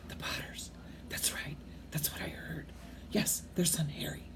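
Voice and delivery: hushed conspiratorial whisper; secretive huddled whisper. A narrator's reading. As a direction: breathe it like gossip you shouldn't hear. Overheard whispering in story.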